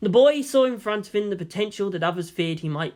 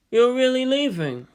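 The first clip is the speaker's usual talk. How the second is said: higher, surprised character voice; 8 semitones higher, 4 dB louder than usual. Acted. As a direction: ask it in disbelief. Character dialogue, raised pitch.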